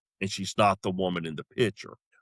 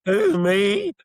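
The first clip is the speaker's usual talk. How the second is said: put-on high, loud kid squeak, like Scooby-Doo; startled ten-year-old kid; 11 semitones higher, 7 dB louder than usual. Nervous man answering his date, high startled kid voice. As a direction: squawk it high like a startled child. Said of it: "I sounded like that ten-year-old kid".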